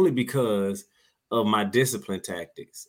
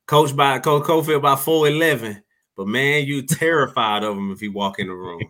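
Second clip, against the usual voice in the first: loud shout-out; 5 semitones higher, 5 dB louder than usual. Real, not acted. Shouting out his coach.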